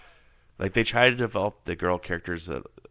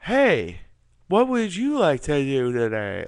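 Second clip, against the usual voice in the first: loud, high, hammed-up bad acting; exaggerated fake come-on, pitched up and loud; mock porno acting; 7 semitones higher, 8 dB louder, slower than usual. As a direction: overact it like a terrible porn actor. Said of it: "doing total porno acting".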